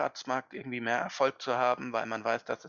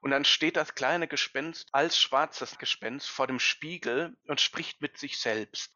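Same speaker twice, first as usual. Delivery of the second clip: normal talking voice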